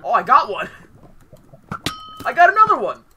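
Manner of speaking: thrilled yelling about kills; excited shout. Real, not acted. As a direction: shout it out, overjoyed.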